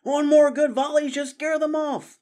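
high, loud rallying yell. A narrator's reading. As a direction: shout to rally the archers. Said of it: "Ambrose Spike yelled".

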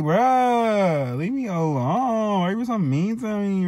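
high, drawn-out whiny plea; plaintive complaint. Retold or imitated. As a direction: whine it like a picked-on kid. Acts out pleading with bullying dad.

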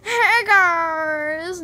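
loud, high, drawn-out greeting; fake episode intro. Vlogger pretending to start an episode, fake intro. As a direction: holler a mock show opening. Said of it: "I'm not starting an episode".

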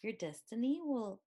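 plain reading voice

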